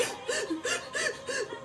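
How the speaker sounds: sharp high gasp with a laugh; mock panic-attack gasp; imitated panic gasp. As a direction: gasp like you can't breathe. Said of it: "heaving or wheezing or whatever".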